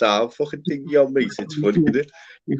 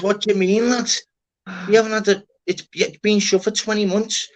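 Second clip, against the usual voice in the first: incredulous lad; 10 semitones higher than usual. Retold or imitated. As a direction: play the lad who can't believe it. Voicing the lad in story.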